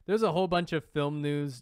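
ordinary talk

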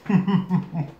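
short laugh through the burn; pained laugh. Real, not acted. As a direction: laugh while chewing.

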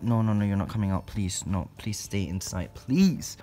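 ordinary talk, nothing marked

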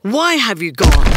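loud, accusing yell; angry shout. A narrator's reading. Small Creature angry at Bird for leaving, loud shout. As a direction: hurl the question out loudly. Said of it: "he shouted".

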